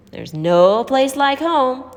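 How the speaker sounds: drawn-out ironic announcement; wry welcome. A narrator's reading. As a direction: show off the squat with irony.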